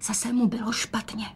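hushed, voiceless aside; whisper. A narrator's reading. Daughter confides in mother about father, whispering. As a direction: whisper it as a secret. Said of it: "dodala šeptem".

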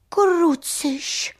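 high, loud exclamation of fright; startled. Acted. Sun seeing Vochomůrka fall from the flower, startled high cry. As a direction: cry out in sudden alarm. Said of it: "leklo se sluníčko".

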